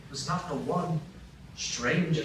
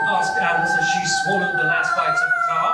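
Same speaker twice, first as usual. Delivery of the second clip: loud narration; 4 semitones higher, 8 dB louder than usual. Acted. Narrator reads very loudly.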